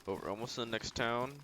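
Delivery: ordinary talk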